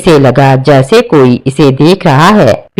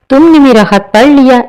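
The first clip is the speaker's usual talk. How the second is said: ghost prince's voice; 9 semitones higher than usual. Acted. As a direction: sound like a spirit from another age. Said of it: "इसने कहा".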